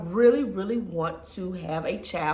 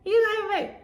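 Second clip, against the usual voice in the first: much higher, fast, nagging mock voice; nosy people mocked; 4 dB louder, much faster than usual. Mocking relatives who keep asking about a baby, high and pushy. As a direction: rattle off the prying question like a busybody. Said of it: "we had people".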